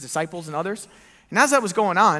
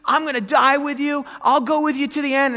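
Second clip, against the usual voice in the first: higher, louder, earnest pledge; Peter's bold vow; 8 semitones higher, 5 dB louder than usual. Acted. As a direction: voice Peter swearing loyalty. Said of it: "And Peter stands up and says".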